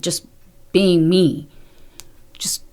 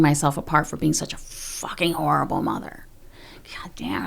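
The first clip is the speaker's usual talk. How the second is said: whispered self-reproach; 4 semitones lower, 6 dB quieter than usual. Real, not acted.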